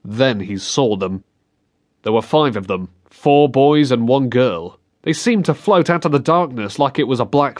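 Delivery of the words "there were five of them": usual speaking voice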